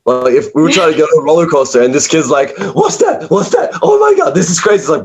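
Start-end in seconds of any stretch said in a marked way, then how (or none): 2.7-4.8 put-on excited kid. Excited kid on roller coaster line.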